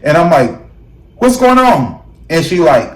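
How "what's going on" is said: high, loud alarmed question; alarmed cry down the phone; hysterical. Retold or imitated. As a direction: retell your own panicked question, pitched way up. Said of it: "I was hysterical".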